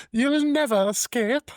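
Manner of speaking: very high, slow threat; high demon voice. Retold or imitated. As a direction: sneer it in a squeaky demon voice. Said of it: "continuing the demon impression".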